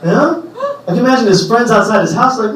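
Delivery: ordinary talk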